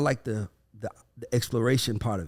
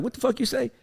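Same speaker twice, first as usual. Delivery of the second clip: mock incredulous dad; 11 semitones higher than usual. Voicing a dad's incredulous response.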